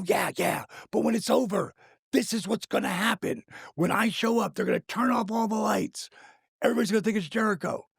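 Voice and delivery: high, eager and fast; excitedly retelling the plan; excited pitch. Retold or imitated. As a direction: sell the big idea with excitement.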